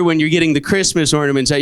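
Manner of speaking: usual speaking voice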